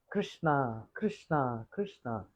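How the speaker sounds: a parrot lovingly repeating Krishna's name; parrot echoing Radharani. Retold or imitated. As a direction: let the name melt away softly. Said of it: "The parrot said".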